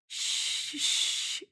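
soft unvoiced shushing; whispered shh to a sleepy lover; hushing. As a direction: hush him gently back to stillness. Jamie hushing sleepy Tyler.

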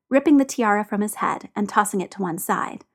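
plain reading voice

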